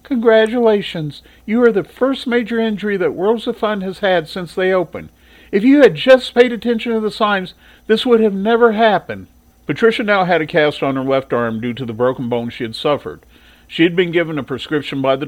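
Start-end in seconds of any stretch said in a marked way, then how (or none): 0.0-9.3 higher, put-on nurse voice, mock congratulating; lecturing nurse. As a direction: scold the girl with fake cheer. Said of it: "nurse told Patricia".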